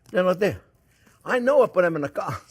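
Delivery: checking the receipt and still no tomatoes; exasperated. Real, not acted. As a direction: land there with disbelief. Searching for missing tomatoes.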